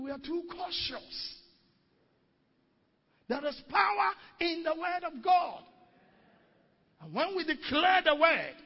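loud, high full-force preaching. Acted. Pastor exhorts believers to boldness, loud high preaching. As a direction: preach it boldly to the congregation.

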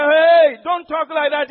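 a loud rebuking call of Hey, then a firm warning; the Lord checks Peter, calling out loud; Lord's rebuke. Retold or imitated. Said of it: "And the Lord said".

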